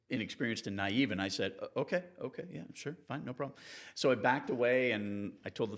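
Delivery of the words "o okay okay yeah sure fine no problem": softer, higher, stammering to calm him; meek backing down. Retold or imitated. Young reporter backing away from the angry sailor, meek softer stammer. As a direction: placate him nervously, quietly giving in.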